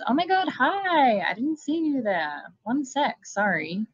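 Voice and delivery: cheerful monster pretending nothing happened; chirpy Kelpie. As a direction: play sweet and startled, caught mid-kill. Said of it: "and then goes".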